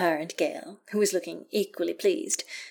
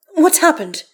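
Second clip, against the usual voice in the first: loud, high urgent demand; 9 semitones higher, 10 dB louder than usual. A narrator's reading. Astarion rushing up to Karlach, demanding. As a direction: demand an answer at once. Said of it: "he demands".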